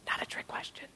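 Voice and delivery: quick stage whisper to the audience; hushed, unvoiced prompt; whispered aside. Real, not acted. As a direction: whisper it as a helpful hint. Joking aside to quiet audience.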